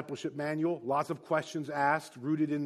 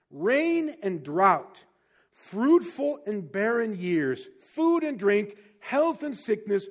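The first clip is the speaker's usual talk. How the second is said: solemn reading; 3 semitones higher, slower than usual. Acted. Slow reading of catechism.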